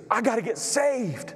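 high, slow, desperate woman's plea; tearful plea. Retold or imitated. A sobbing woman asks the pastor to save her. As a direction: voice her like she's crying out. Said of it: "she is just bawling".

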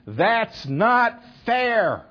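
drawn-out, high, loud mock howl; howled protest. Students howling at their professor over failing grades, mock outraged scream. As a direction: scream it in protest, stretching every word out high. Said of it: "howled in protest, screaming".

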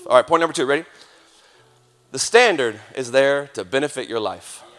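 ordinary delivery

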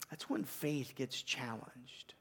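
slow near-whisper, dropping right down; hushed. Real, not acted. Preacher to congregation, slow hushed whisper. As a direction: let it sink in, barely voiced.

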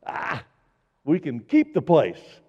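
lightly voiced crowing Satan; gloating Satan. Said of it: "turned to all his little demon buddies and said".

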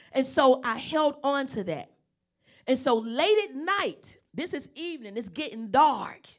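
rising sermon build; full preaching. Real, not acted. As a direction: preach it to the congregation with fire.

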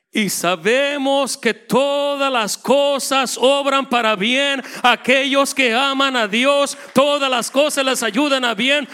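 loud, high, slow pulpit proclamation; full-force preaching. Acted. Preacher to congregation, proclaiming loud and slow. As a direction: thunder the verse out to the congregation.

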